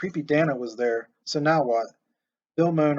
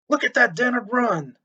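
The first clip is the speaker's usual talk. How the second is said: much higher, thrilled cry at the TV; marveling; 9 semitones higher, 4 dB louder than usual. A narrator's reading. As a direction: gasp with delight at the run. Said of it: "Steve marveled".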